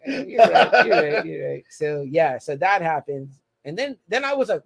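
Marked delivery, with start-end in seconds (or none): 0.1-1.6 laughing as he gives in; laughing concession. Retold or imitated. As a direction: concede the point through a laugh. Conceding when called out.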